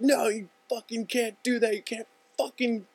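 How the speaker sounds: high-pitched mock tirade; hammed-up raging motorcyclist, up in his face; scary mad. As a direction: rant to frighten the other driver. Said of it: "scaring Hunter and shit".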